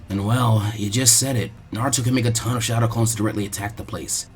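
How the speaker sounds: a tactician calmly walking through it; low, quiet explanatory boy; Shikamaru explaining. Acted.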